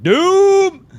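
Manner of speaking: put-on very high, loud drunken "Doom"; drunk college kid. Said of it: "You guys say it like a drunk college kid".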